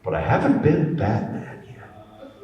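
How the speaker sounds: ordinary talk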